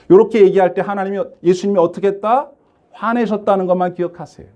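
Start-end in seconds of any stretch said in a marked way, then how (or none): none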